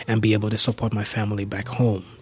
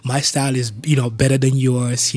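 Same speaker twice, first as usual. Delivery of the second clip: quick, louder mock boasting; playful teasing; 2 semitones higher, 5 dB louder than usual. Friends teasing each other over dancing, playful boasting. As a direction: re-enact friendly trash talk. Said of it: "we would tease each other".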